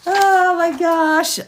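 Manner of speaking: high, loud, drawn-out oh my gosh. Real, not acted. Reading the birth certificate subsections, high slow exclamation.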